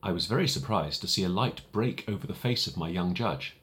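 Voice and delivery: ordinary delivery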